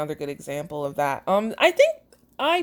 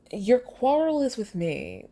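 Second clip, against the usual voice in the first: slow, higher mock-dramatic delivery of "Your quarrel is with me."; mocking dramatic line; 6 semitones higher than usual. Shown as a demonstration. Mocking her own stilted dialogue for viewers, slow and hammed. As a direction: ham up the stilted line to show how silly it is. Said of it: "Who talks like that?".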